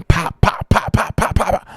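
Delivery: rapid, high popping noises; imitated electrical popping. Storyteller mimicking the sparking wire, rapid sharp pops. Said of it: "that shit was popping".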